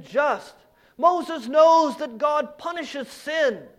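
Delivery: loud, driving sermon delivery; forceful preaching. Real, not acted. Preacher telling the congregation what Moses knows, forceful preaching. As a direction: hammer each repeated point home from the pulpit.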